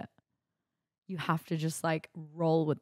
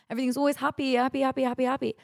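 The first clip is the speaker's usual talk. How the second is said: higher, sing-song fake cheer; mock chirpy; 8 semitones higher, faster than usual. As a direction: parody relentless online happiness. Mocking social media positivity.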